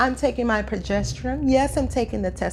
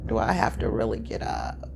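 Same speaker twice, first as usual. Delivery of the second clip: sleepy groan; 14 semitones lower, 9 dB quieter than usual. Retold or imitated. Groggy at 4:30 am.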